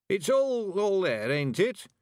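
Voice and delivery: put-on fretting old man needing reassurance; anxious. A narrator's reading. Mister Weevil asking whether his savings are there, anxious. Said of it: "said the old man anxiously".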